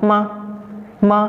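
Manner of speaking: fourth-tone "Ma", quick fall; pressed falling tone. Shown as a demonstration. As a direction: press "Ma" down abruptly.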